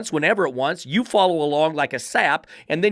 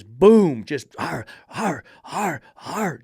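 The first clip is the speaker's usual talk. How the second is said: loud boom then growled ar ar; dog lunging noise; 3 dB louder, much slower than usual. Retold or imitated. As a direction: mimic the dog straining at the chain.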